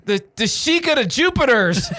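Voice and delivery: put-on high-pitched drunken fumbling; hammed-up drunk blunder. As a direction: stumble through the big reveal as if plastered. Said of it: "that's my drunk voice".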